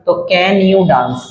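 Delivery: ordinary talk, nothing marked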